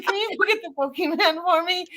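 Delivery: a coy little favor asked of the waiter; sweet put-on voice asking for a favor; asking sweetly. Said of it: "I said".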